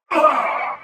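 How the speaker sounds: a loud sharp grunt of pain. Acted. Hit in combat, loud pained grunt. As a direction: grunt as if struck.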